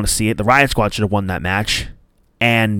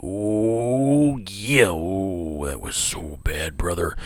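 Macho Man impression; 3 semitones lower, slower than usual. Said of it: "impression of your frenemy, Macho Man Randy Savage".

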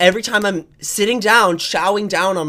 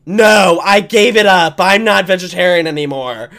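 loud, exasperated retort; mock exasperation; 8 dB louder than usual. Answering brothers who ask about being vegetarian, loud mock exasperation. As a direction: act out a loud, fed-up comeback. Acts out snapping at nagging brothers.

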